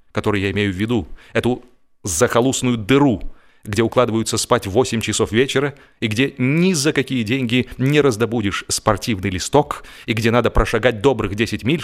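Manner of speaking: ordinary delivery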